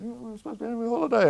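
high, fast put-on voice of the wife; wife's fast, high mock grumble; mock complaining wife. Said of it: "My wife's going".

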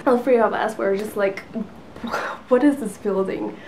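ordinary delivery

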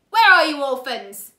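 loud, scratchy villain voice; menacing villain. A narrator's reading. Count Olaf hunting the orphans through the house, loud. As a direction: demand it like a sneering guardian.

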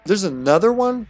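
high, loud incredulous question. Real, not acted. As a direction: exclaim it in exasperated surprise. Repeats it in louder disbelief.

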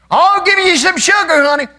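very high, loud doting aunt voice; Aunt Sally. Retold or imitated. Preacher imitating his aunt offering a kiss, very high doting voice. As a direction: sing it out like a fussing aunt. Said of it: "Then my Aunt Sally".

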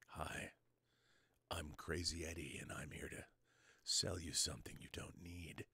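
hushed salesman whisper; whispered pitch. Shown as a demonstration. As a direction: whisper the sales line like a secret. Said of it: "speak like this".

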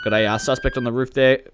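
ordinary talk

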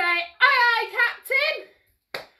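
high, loud reply to the captain; crew call. Shown as a demonstration. Instructor showing children the crew's answer, high loud call. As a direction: sing out like an eager deckhand.

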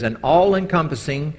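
reading a definition. Shown as a demonstration. Preacher reading the physics definition aloud, lightly formal. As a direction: read the definition out with weight. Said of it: "this is a definition".

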